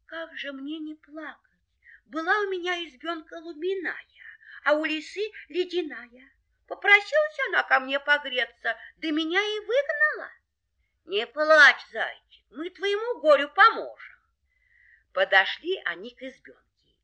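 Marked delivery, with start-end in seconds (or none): 0.1-10.3 high, plaintive hare voice; weeping little hare. Acted. Hare tells dogs of losing his hut, tearful. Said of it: "горько плачет". 11.1-14.2 loud, higher comforting animal voice; reassuring dogs. Acted. Dogs promise the hare help, reassuring.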